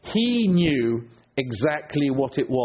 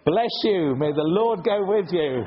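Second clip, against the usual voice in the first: gracious blessing; 4 semitones higher than usual. Retold or imitated. To a driver who cut in, a gracious blessing. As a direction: bless them sweetly instead of snapping. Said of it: "you say".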